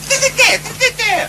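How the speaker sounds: high squeaky mimicry of a bandmate; mocking whine. Musician mocking his bandmate in a high squeaky voice.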